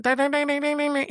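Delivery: high mock Alabama-Arkansas twang noises; imitated Southern twang. Coworker from Alabama or Arkansas putting it on, imitated high twang. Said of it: "He could talk like that".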